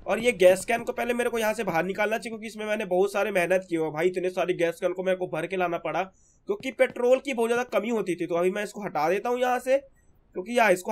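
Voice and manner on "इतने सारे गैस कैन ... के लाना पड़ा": ordinary delivery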